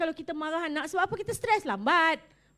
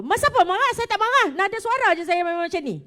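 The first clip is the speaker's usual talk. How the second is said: angry denial; 6 semitones higher, 7 dB louder, faster than usual. Retold or imitated. Acted angry person denying anger.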